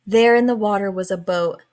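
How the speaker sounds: ordinary delivery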